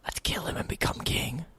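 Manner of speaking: hushed conspiratorial whisper. Real, not acted. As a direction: whisper the scheme. Joking plot to kill king.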